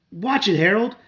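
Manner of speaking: put-on a boy shouting at the dog. A narrator's reading. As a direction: snap it out as a warning. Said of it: "Pete shouted".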